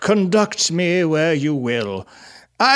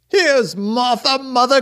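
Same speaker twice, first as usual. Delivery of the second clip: put-on excited children's cry; 10 semitones higher than usual. A narrator's reading. Young Cratchits announcing Martha to mother, excited cry. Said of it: "cried the two young Cratchits".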